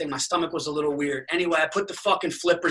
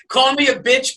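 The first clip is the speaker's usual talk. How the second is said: laughing while talking, higher and louder; 8 semitones higher, 5 dB louder than usual. Real, not acted. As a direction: laugh it out mid-sentence. Laughs at friend's comment.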